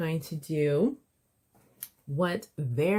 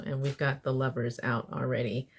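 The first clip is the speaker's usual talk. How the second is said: ordinary delivery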